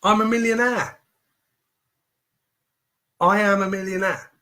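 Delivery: energised self-belief mantra; powerful affirmation. Shown as a demonstration. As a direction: proclaim it with pumped-up conviction. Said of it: "Say that to yourself. Practice it".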